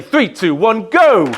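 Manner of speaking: loud crowd-rousing countdown. Real, not acted. Counts crowd down for level two.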